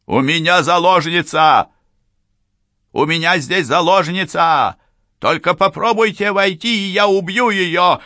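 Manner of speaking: loud, high threatening yell; killer shouting. A narrator's reading. Killer warning police from behind the door, shouting. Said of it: "убийца кричит из-за двери".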